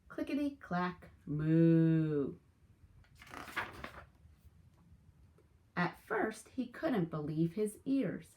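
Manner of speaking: lively storybook read-aloud; animated picture-book narration; reading to children. Acted. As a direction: tell it to a class of young kids.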